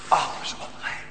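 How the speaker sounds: whispered, grave "alles onrein"; hushed dread. Real, not acted. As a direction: breathe it out in awe. Solemn conclusion in sermon.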